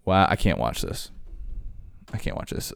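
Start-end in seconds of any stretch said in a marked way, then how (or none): 2.1-2.7 whispered under the breath. Real, not acted. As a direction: drop to a hushed whisper, repeating it. Repeats line, then whispers.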